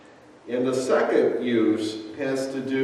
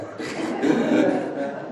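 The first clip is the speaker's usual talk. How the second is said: laugh; 4 semitones higher than usual. Real, not acted. Laughing at his own impression.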